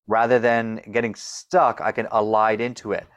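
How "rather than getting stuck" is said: ordinary delivery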